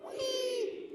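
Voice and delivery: high thin squeal; roller coaster wee. Shown as a demonstration. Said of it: "So three".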